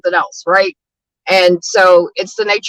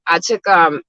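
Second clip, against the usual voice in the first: ordinary talk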